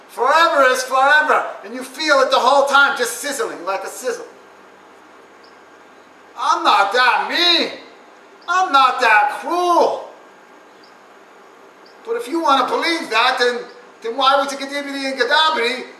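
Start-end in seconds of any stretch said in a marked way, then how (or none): 6.4-10.1 slowed right down, drawn out; slow protest. Acted. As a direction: lean on each word slowly. God character slow protest.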